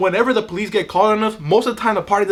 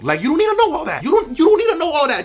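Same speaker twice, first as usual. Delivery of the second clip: high exasperated; 8 semitones higher than usual. Acted. Telling relatives to back off.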